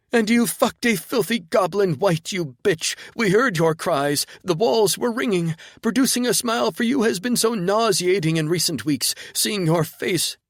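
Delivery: high, loud, unhinged rant; hysterical drugged woman. Acted. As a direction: rave in a manic high voice.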